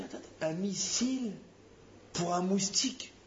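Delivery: disbelieving teasing question; incredulous rebuke. Acted. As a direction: gently scold a father overreacting. Rebuking the angry father.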